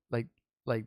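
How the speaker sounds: usual speaking voice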